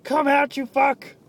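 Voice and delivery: shouted at the stuck object, high and loud; angry yell. Retold or imitated. Yelling at a stuck wallet, angry shout. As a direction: bellow it in frustration. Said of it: "It's like".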